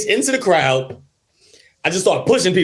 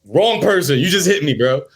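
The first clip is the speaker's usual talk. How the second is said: normal talking voice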